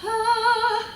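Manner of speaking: high, loud "ah" on a breath; big demonstrative breath sound, pitched high; vocalised breath. Shown as a demonstration. Voice teacher showing students breathing in, loud breathy "ah". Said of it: "like when we're breathing in".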